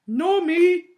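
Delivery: high, loud whiny agreement; creepy knight. A narrator's reading. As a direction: chime in like a toady.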